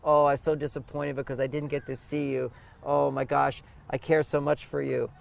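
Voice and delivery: a man softly telling his woman he loves her; loving words to a partner. Retold or imitated. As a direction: murmur it like sweet nothings to a lover. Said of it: "talk about positive emotions with the woman".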